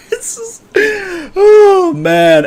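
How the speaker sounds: squealing high through laughter; helpless laughter. Real, not acted. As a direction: wheeze the words out between laughs. Overcome laughing at the drawing.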